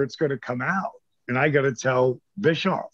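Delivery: usual speaking voice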